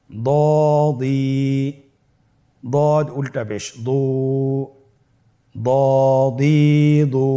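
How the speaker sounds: measured recitation with long held vowels; spelling drill. Shown as a demonstration. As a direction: spell out each letter, then stretch the syllable. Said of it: "উচ্চারণ করুন".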